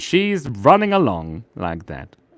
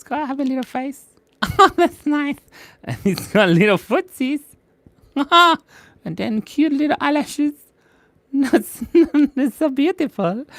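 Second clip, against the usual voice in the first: cute voice; 13 semitones higher than usual. Acted. Cute voice for drawn bongo.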